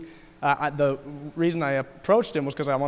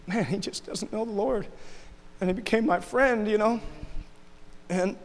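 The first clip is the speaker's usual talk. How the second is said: choked up and higher, fighting back tears; tearful; 4 semitones higher than usual. Real, not acted. Tearing up telling the church about his new friend. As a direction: let the voice crack as emotion rises. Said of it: "sorry for just crying on you guys".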